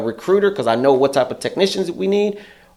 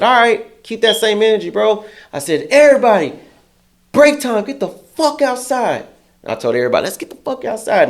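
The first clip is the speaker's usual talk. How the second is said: mock commanding call; 8 semitones higher than usual. Calling everyone outside.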